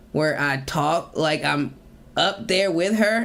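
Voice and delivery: ordinary talk, nothing marked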